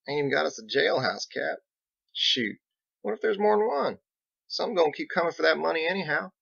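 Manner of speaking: a backwoods lawman raising worries; Biggs. Acted.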